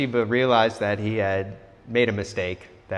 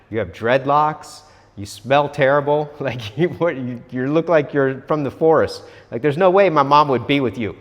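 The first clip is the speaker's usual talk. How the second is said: raised, louder, incredulous put-down; indignant child's retort; 5 semitones higher, 7 dB louder than usual. Retold or imitated. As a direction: argue back in escalating disbelief, higher and louder. Boy scornfully refuses stranger entry.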